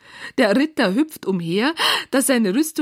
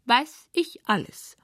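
slow, higher-pitched dismissive knight; interrupting; 4 semitones higher, slower than usual. A narrator's reading. Knight cutting off Clara's explanation, impatient. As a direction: cut her off impatiently. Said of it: "unterbricht sie der Ritter".

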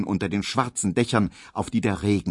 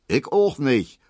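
raised, higher-pitched call; quick higher interjection; 6 semitones higher, 3 dB louder than usual. A narrator's reading. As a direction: chime in with a mild shout. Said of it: "ruft Emil".

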